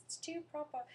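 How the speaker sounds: put-on high, loud, over-proper British accent; mock British accent. Mocking Americans trying British accents, high exaggerated British. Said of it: "Americans trying to do British accents".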